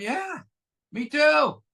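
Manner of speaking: sudden high yelp of me too; blurted agreement. Retold or imitated. Newcomer at his first meeting blurts out, high and loud. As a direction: burst out agreeing before thinking.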